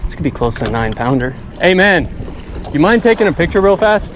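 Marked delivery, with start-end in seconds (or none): 1.6-4.1 calling out. Real, not acted. Angler calling to a nearby boater for a photo, raised voice. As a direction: hail a stranger on another boat.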